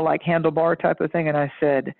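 usual speaking voice

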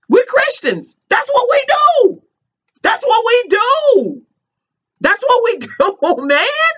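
high, slow mocking repeat of we're christians; mock pious. Mocking the family who call themselves Christians, sing-song.

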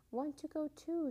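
put-on high-pitched little child's plea; mild sobbed plea. A narrator's reading. Small girl left at the door, mildly sobbing. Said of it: "she sobbed".

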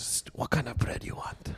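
slow, hushed mock-secretive whisper; furtive whisper. Pretend sandwich worker hiding a pigeon asks a customer, whispering. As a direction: whisper it like a shady offer.

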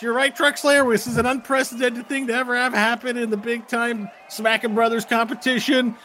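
loud announcer voice stumbling over the event name; intercom announcer. Acted. GM voicing the announcer to the players, hammed-up tournament host. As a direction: boom it out like a tournament host on the speaker.

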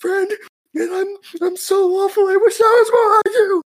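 very high, loud sobbing wail; mock anime crying. Host parodying gross anime crying, hammed-up sobbing wail. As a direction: wail it like an overdone anime sob. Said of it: "'cause there's like".

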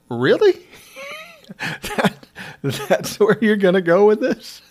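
笑いながら呆れて; incredulous laugh. Retold or imitated. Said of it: "I go".

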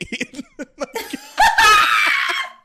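shrieking laugh; loud high laugh. Real, not acted. Cracking up at her own McDonald's story, loud. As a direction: burst out in a loud high cackle.

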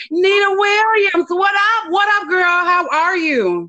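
high, bright hype welcome; excited greeting. Real, not acted.

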